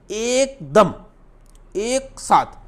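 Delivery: "एकदम" and "एक साथ" drawn out high, with pauses; stressed key phrase. Shown as a demonstration. Teacher emphasising key meaning.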